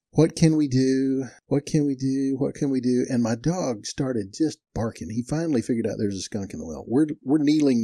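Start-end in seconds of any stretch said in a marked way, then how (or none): 0.1-3.0 musing repetition. Retold or imitated. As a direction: chant it to yourself while thinking. Acts himself thinking hard.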